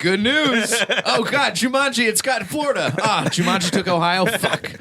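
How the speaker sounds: high-pitched pretend election-night dismay; mock election panic. Podcaster riffing on Jumanji winning states like election night, high mock distress.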